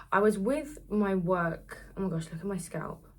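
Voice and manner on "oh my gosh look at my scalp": ordinary talk, nothing marked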